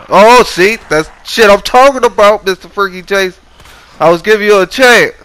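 loud, high yelling at the screen; panicked yelling. Real, not acted. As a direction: yell at the zombie grabbing you. Yelling at attacking zombie.

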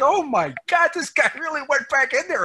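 high, loud burst of amazed delight; amazed exclamation. Retold or imitated. Panelist recalling finding the congressional record, amazed and excited. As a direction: relive the moment you saw the pages.

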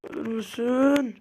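slow, loud begging; loud plea. Real, not acted. Gamer loudly begging an enemy to just die. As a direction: drag it out, pleading loudly.

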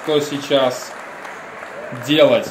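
ordinary talk

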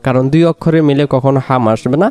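ordinary talk, nothing marked

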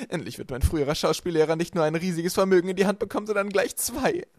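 loud, high-pitched gloating from above; cruel, gloating villainess. A narrator's reading. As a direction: crow over your helpless captives. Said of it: "jedes ihrer grausamen Worte". (grausam triumphierend, laut und hoch)

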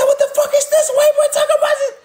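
high, fast, laughing mock of the baby mama. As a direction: squeal it out like a shocked mother of his kids, laughing. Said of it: "She said".

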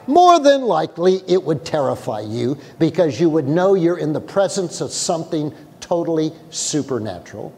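ordinary talk

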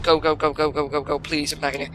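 soft urgent pleading; hushed begging. Real, not acted. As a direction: beg quietly and urgently. Desperate whispered urging.

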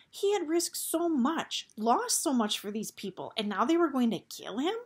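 rising outrage. A narrator's reading. Narrator voicing Gregor's outrage.

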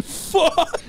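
high, laughing mock scream. Finishing a crude rhyme, laughing mock scream. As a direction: pitch it up in a half-laughed cry. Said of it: "And he's screaming".

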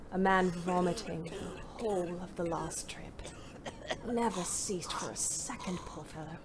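hushed, near-whispered grief. A narrator's reading. Driver remembering a dying patient, hushed and quiet. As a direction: let it sink into a whisper. Sad memory of dying man.

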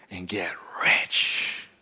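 slow hushed whisper of a promise; secretive whisper. Retold or imitated. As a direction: lean in and whisper it. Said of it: "It's something like this".

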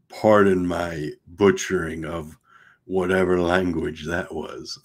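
ordinary delivery, nothing marked

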